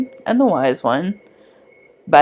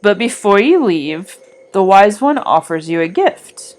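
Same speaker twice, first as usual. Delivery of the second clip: guided meditation. Acted. Guiding listeners back from the Wise One's clearing, calm meditation voice. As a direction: guide the listener calmly back along the forest path.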